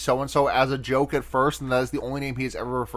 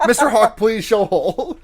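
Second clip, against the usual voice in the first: mock pleading; 6 semitones higher, 5 dB louder than usual. Jokey mock plea after the mishearing.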